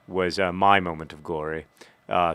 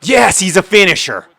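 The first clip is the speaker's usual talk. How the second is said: much higher, loud yell of joy; triumphant shout; 11 semitones higher, 10 dB louder than usual. Real, not acted. Gamer celebrating a scored penalty, shouting. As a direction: scream yes at the goal.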